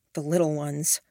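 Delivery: usual speaking voice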